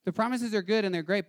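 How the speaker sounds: ordinary talk, nothing marked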